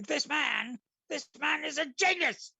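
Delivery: high, loud mock proclamation from atop a table; excited proclamation. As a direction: announce it to the whole room, thrilled.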